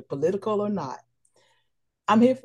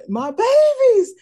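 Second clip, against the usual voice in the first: much higher, louder, slow sing-song call; delighted greeting; 8 dB louder, slower than usual. Retold or imitated. Former PTA mom greeting her kids, sing-song call. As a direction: call out to them with joy. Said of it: "every time I see you all out".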